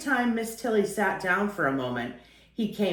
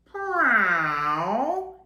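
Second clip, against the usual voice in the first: louder, crying meow with a long rise; a cat wailing to be let in; a hungry cat pleading at the door; plaintive meow; 2 semitones higher, 4 dB louder than usual. Acted. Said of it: "he said again".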